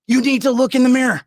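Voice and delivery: loud repeated demand; angry. Real, not acted. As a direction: drive it in again.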